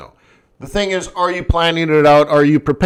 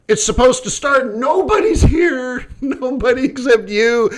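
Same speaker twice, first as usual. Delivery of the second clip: panicked cry; 7 semitones higher than usual. Retold or imitated. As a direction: wail it like the show's collapsing. Panicked organizers quoted.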